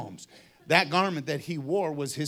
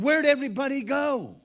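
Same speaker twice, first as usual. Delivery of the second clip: higher-pitched, concerned question; puzzled concern; 6 semitones higher, 4 dB louder than usual. Acted. Blind man finds his town empty, puzzled. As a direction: wonder where they all went, raised pitch.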